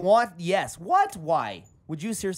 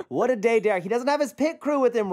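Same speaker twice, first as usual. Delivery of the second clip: ordinary talk, nothing marked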